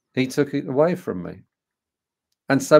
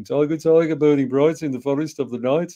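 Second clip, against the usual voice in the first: higher-pitched Brummie schoolboy recital, louder on the opening; thick Brummie accent; 5 semitones higher, 4 dB louder than usual. Retold or imitated. Pupil reading Blake's poem aloud for his English teacher, Brummie accent. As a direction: recite it in your best Birmingham accent. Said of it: "with my best Brummie accent".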